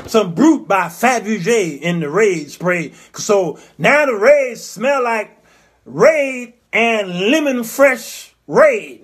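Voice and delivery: slowed right down, each scent savoured; very slow sarcastic listing; mocking slow. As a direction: draw it out like an ad.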